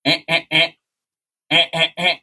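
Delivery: high, short crow-call imitation; crow squawk. Crow scolding from the limb, imitated squawks. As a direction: mimic a crow squawking in threes. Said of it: "And she said".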